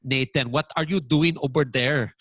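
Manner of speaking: put-on Filipino-accented English. Shown as a demonstration. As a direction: imitate a Filipino mother's accent. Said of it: "my Filipino accent".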